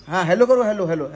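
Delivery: normal talking voice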